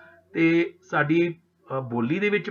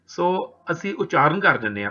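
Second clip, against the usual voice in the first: normal talking voice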